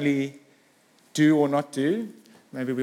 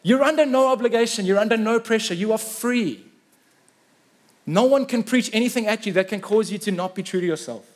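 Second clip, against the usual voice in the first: Peter proclaiming; 5 semitones higher, 3 dB louder than usual. Retold or imitated. Preacher voicing Peter to Ananias, declaring louder and higher. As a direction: lean in and declare it like an apostle setting them free. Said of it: "And Peter's going".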